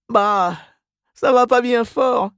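high-pitched woman's moan; complaining Mrs Reilly. A narrator's reading. Mrs Reilly admits she's unwell, complaining. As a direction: grumble in a high put-on voice.

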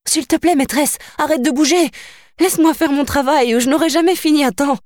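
put-on high, pleading young servant; pleading seamstress. A narrator's reading. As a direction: beg her to stand still, pitched high.

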